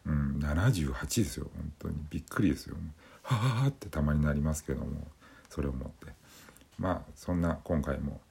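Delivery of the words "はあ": high breathy surprised gasp; startled gasp. Retold or imitated. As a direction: gasp like something startled you.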